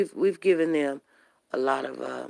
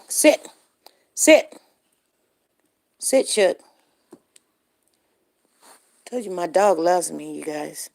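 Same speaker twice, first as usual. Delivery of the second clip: normal talking voice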